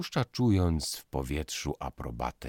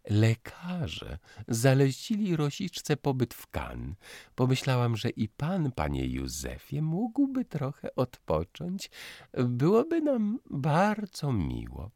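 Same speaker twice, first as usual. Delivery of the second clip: genteel society matron; 12 semitones higher, 3 dB louder than usual. Acted. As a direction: coax the young man with sugary politeness. Mother invites Józef.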